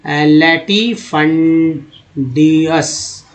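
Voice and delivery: लैटिफंडियस stretched very slowly, syllable by syllable; very slow sounding out. Shown as a demonstration. Teacher to students, very slow pronunciation. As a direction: drag लैटिफंडियस out for learners. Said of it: "teacher sounds out word".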